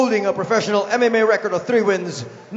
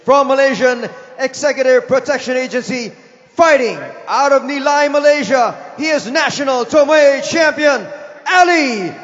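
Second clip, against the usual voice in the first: ring announcer; 6 semitones higher, 6 dB louder than usual. Acted. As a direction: stretch out the name and roar it. Ring announcer introducing fighter.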